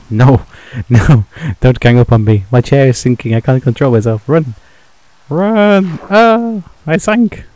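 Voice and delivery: panicked loud yelling while fleeing; frantic shouting, running for your life; being chased. Real, not acted. Chased by spiders and creeper.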